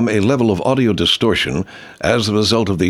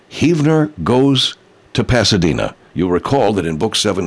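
ordinary delivery

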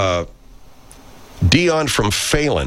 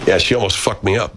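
ordinary talk

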